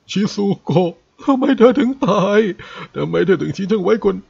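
a husband wailing over his dead wife; lamenting. Acted. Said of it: "ไทโซเอาแต่คล่ำครวญ". (คร่ำครวญเสียงดังด้วยความโศกเศร้า)

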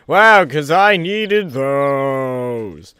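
very slow, stretched-out mock thanks; sarcastic gratitude. Real, not acted. Player thanking the game for a reward, sarcastic. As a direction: drag out the vowels in loud fake gratitude.

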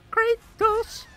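rising, stretched cry of the name; excited callout. Real, not acted. Gamer yelling as the opponent's super hits, excited. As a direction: call the name out high and drawn-out.

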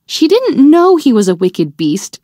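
plain reading voice

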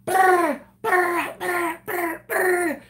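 high, loud rapid brr of tommy guns; imitating tommy guns; mock machine gun. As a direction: rattle it out like gunfire. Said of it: "They are going so hard".

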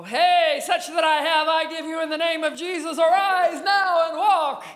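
loud, high biblical proclamation; booming Bible healer. Pretend. The grand Bible healing he did not do, proclaimed loudly. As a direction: thunder it like a prophet. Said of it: "I wish I could tell you".

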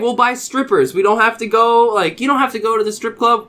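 high, loud insistent pitch; mock persuading. Stranger coaxing them back to his place, insistent.

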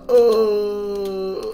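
long, high falling "oh" wail; pained cry. Acted. Spring Boy hit by cab, drawn-out wail. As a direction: howl a long oh, sliding down.

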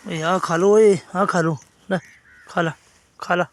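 loud call to eat. Real, not acted. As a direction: call the puppies over loudly. Calling puppies to eat.

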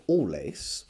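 usual speaking voice

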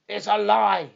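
angry trainer shouting back at Holmes; shouted. Acted. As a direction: shout the denial at him. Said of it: "he shouted".

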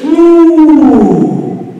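long, sung-out moo; cow moo. Shown as a demonstration. Teacher imitating a cow for the class, long held moo. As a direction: hold the moo like a cow lowing. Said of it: "A cow makes some moo".

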